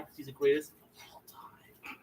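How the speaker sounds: hushed, reverent whisper; dramatic whisper. Acted. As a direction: lean in and whisper it like a secret. Dramatic hushed claim about the champion.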